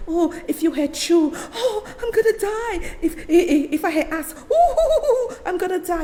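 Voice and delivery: high, exaggerated crying and laughing, mocking the overreaction; mock dramatic wailing. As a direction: ham up a fake fit of horror, sob-laughing. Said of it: "some of y'all are acting like".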